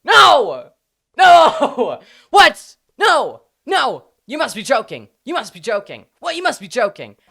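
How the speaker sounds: loud, high-pitched wails of disbelief; mock outraged crowd. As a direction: yell in exaggerated horror, as if scandalised. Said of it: "everyone was going".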